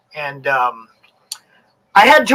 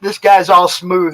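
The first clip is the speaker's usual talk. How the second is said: ordinary talk, nothing marked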